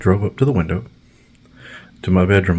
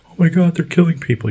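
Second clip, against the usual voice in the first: very high shrieking busybody; high mock panic; mock hysterical cat lady; 10 semitones higher than usual. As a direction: squeal it like a panicked nosy neighbor. Mocking nosy cat lady.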